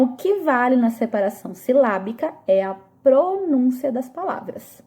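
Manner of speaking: ordinary talk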